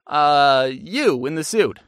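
hesitant pick. Retold or imitated. Aaron calls on audience member.

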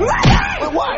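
high shocked yelp; shocked shout. Acted. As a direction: shout it out in disbelief. Jeffy hears Santa isn't real.

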